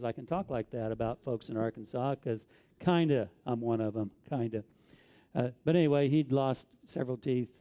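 usual speaking voice

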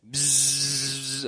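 soft, low bzzz like a bee; buzzing bee sound. Shown as a demonstration. Pastor imitating the buzzing of bees, soft bzzz. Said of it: "I'll emphasize that".

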